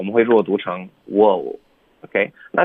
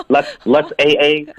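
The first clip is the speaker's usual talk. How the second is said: slow put-on Let's, let's A A; mock Chinglish; 4 semitones higher, 3 dB louder, slower than usual. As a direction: play the clueless diner saying AA.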